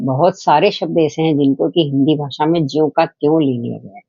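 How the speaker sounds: ordinary talk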